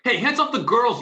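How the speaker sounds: loud, high battle shout. Acted. Football fan charging the police beating women, loud shout. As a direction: roar it as you charge in.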